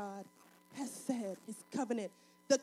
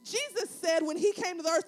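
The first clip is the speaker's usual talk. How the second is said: ordinary talk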